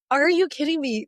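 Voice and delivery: mock scream of joy; thrilled disbelief. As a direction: shout it in delighted shock. Screaming in parking lot.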